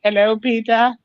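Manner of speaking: high, rising sing-song voice; sing-song morning greeting. Retold or imitated. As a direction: tease him with a high singsong. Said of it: "I wake up and I go".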